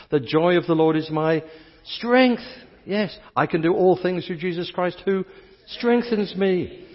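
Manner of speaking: loud, high, building declaration; fervent proclamation. Real, not acted. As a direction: proclaim it with rising fire. Proclaiming scripture with rising fervor.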